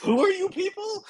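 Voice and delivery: sudden high comic squeak. Acted. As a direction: squeak it out in disbelief.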